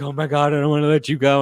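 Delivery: loud, high overexcited squeal of words; mock gushing. Woman hugging her fiance first time, mock gushing.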